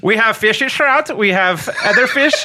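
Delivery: high, slow mock Belgian breaking into laughs; laughing Belgian accent. Belgian trout farmer to tourist, laughing mock Belgian accent. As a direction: hold a Belgian accent through giggles. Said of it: "this is why I didn't wanna get into accents".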